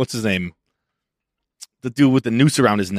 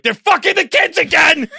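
very high-pitched outraged yell; hammed-up alarmist cry; mock hysterical shout; 4 dB louder than usual. Conspiracy theorist reacting to locked Twitters, hysterical.

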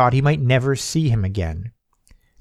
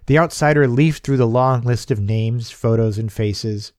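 ordinary delivery, nothing marked

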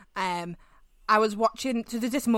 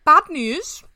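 louder, higher dramatic declaration; mock announcement; 6 semitones higher, 10 dB louder than usual. Telling girlfriend the bad news, louder and higher. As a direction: announce it grandly.

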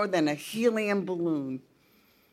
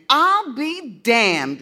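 slow, louder, stunned dad voice; father, slow and stunned; 6 semitones higher, 7 dB louder, much slower than usual. A narrator's reading. Father reading the paper, slow stunned voice. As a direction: drag it out in disbelief.

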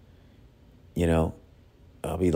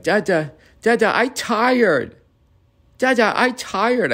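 put-on very high, drawn-out toddler plea; pleading toddler; 4 dB louder, slower than usual. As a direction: imitate a tired little kid, slowly. Voicing toddler resisting sleep.

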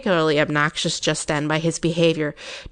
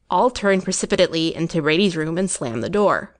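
ordinary delivery, nothing marked

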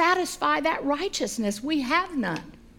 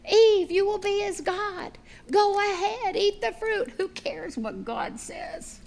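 lightly voiced serpent, high then sinking slower; coaxing tempter; 6 semitones higher than usual. Satan urging Eve to eat, lightly voiced coaxing.

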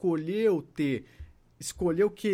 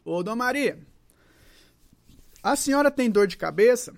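put-on higher, louder call to an older lady; raised voice, put the question to Dona Maria; questioning the patient; 5 semitones higher, 6 dB louder than usual. Therapist asks Dona Maria about her headache, raised questioning voice.

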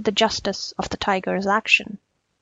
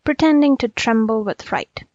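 plain reading voice

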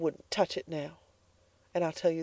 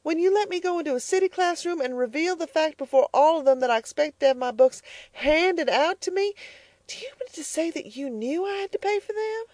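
much higher, indignant accusation; demanding; 12 semitones higher than usual. Acted. Elnora confronting her mother, much higher and indignant. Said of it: "she demanded".

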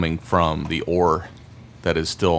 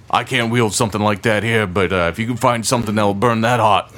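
higher, louder burly smith; gruff blacksmith voice; 4 semitones higher, 5 dB louder than usual. Acted. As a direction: boom it out like a proud smith. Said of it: "He kinda chuckles".

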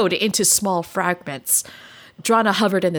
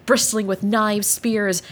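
ordinary talk, nothing marked

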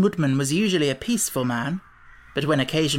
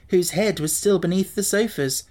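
ordinary delivery, nothing marked

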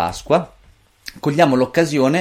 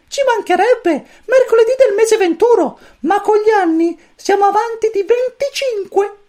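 very high, squeaky little chick voice; cosmic chick voice; 6 dB louder than usual. A narrator's reading. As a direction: chirp it like a tiny alien chick. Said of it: "voce del pulcino cosmico". (vocina acutissima da pulcino)

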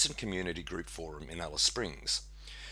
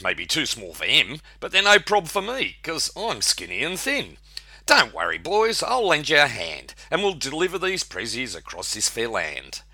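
higher, bright and cocky; cheeky snake voice; 7 semitones higher, 6 dB louder than usual. Acted. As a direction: offer help with cheeky confidence. Snake Jake offers help.

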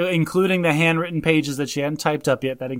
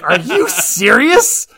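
slow, loud disbelief, pitched up; incredulous; 5 semitones higher, 8 dB louder, much slower than usual. Retold or imitated. Laughing listener asking the movie if it is serious, loud slow disbelief. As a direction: stretch it out in disbelief.

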